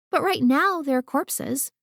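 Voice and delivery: put-on high, stubborn child's retort; arguing child. A narrator's reading. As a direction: insist like a know-it-all kid. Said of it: "Olivia argued".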